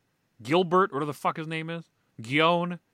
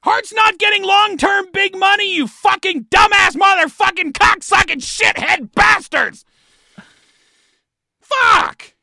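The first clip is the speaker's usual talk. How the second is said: screaming in rage, higher and louder; furious shout; 12 dB louder, slower than usual. Real, not acted. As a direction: explode at the team. Furious rant at team management.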